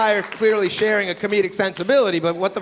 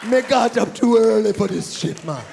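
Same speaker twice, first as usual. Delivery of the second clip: higher, slow put-on Jamaican patois; mock Jamaican accent; 5 semitones higher, slower than usual. Comic doing the sleeping Rasta man in a mock Jamaican accent. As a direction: drawl it like a groggy Rasta in Jamaican.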